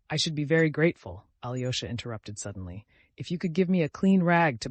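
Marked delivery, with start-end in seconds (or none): none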